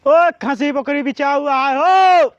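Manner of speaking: loud, drawn-out vendor call; carrying sing-out to the whole lane; street hawker's cry. Acted. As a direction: call out for goats to buy, stretching the last word. Goat buyer crying through the village.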